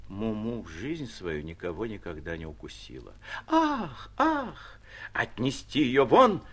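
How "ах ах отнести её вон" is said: громче и медленно, с раздражением; барыня гонит собаку, голос изменился от гнева; изменившимся голосом. A narrator's reading. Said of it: "проговорила изменившимся голосом старуха". (раздражённая старуха)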